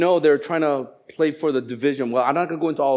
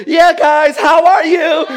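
louder and higher, hammed-up co-leader enthusiasm; mock peppy hello; overeager cheer; 13 semitones higher, 10 dB louder, slower than usual. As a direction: play the eager co-leader rallying the group. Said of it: "the co-leader goes".

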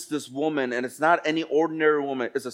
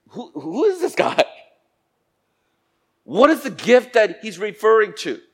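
higher, imitated puzzled woman; baffled wonder; 5 semitones higher, 5 dB louder than usual. Woman wondering about the stranger at the well, baffled wonder. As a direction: mimic her stumbling, high-pitched disbelief. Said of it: "It's just like".

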